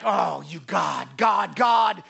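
mock exasperated outburst. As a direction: act out a man fuming. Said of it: "then he got even more mad".

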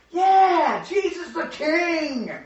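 high, loud, drawn-out cheer; cheering crowd. Acted. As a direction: hail the king like a jubilant crowd. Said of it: "The people are like".

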